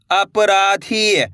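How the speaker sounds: अपराधी very slowly, each syllable stretched; sounding out. Shown as a demonstration. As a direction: draw out अपराधी for learners to repeat. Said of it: "मेरे साथ साथ इनको repeat". (अपराधी, धीरे-धीरे खींचकर)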